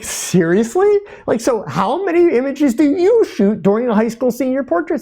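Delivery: retold incredulity. Retold astonished challenge to heckler.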